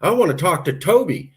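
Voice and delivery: a hint of a stranger's put-on voice; visitor asking for Toby. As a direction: ask for Toby like a curious stranger. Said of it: "if you go to Dumas and you go".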